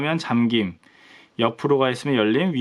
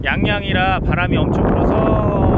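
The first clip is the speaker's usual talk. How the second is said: raised, higher voice against strong wind; shouting over wind; 4 semitones higher, 4 dB louder than usual. Real, not acted. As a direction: project loudly over the gusts. Windy outdoor site, apologizing for sound.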